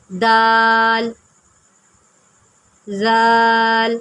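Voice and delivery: دال and زال stretched very slowly; دال، زال with long held aa; long drawn letters. Shown as a demonstration. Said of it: "اس میں غلطی یہ ہوتی ہے".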